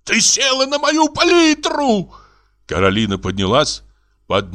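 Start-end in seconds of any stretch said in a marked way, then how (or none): 0.0-2.1 slow, very high-pitched yell; theatrical aghast shriek; outraged yell. A narrator's reading. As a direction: stretch the words in a horrified high cry. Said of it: "заорал Бутронце".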